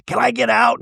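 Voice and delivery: high, lightly mocked plea; pleading captive. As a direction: imitate someone begging to be let out. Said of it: "You know, it sounds".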